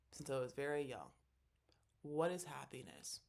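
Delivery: normal talking voice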